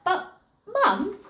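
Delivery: high whine with a laugh; protesting boy. Acted. Jack answering his mum, high and protesting. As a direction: protest like a cheeky child. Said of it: "Jack protested".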